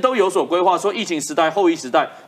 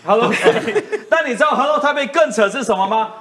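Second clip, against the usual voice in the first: laughing; 6 semitones higher than usual. Real, not acted. Laughs at the joke.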